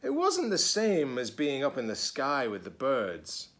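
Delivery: put-on reading to children. A narrator's reading. Teacher reading a picture book to children, lively. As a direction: read it brightly to the kids.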